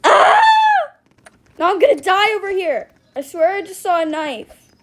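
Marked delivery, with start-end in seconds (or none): 0.1-0.4 sudden high shriek; shriek, startled, much higher and louder; scared scream. Real, not acted. Gamer screams when the knife appears.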